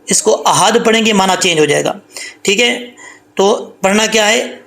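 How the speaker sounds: ordinary talk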